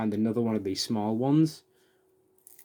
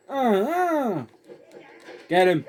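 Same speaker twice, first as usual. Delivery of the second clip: high, loud startled cries; alarmed yelp; 10 semitones higher, 5 dB louder, slower than usual. Real, not acted. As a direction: panic as the enemy charges. Combat panic.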